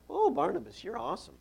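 high-pitched mocking gush; sing-song, resentful mimicry of admirers; mock fawning. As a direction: imitate an adoring crowd, sarcastically. Mimicking crowd fawning over rival.

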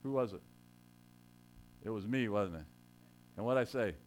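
usual speaking voice

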